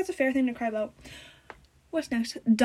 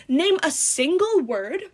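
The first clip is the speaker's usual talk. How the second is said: ordinary delivery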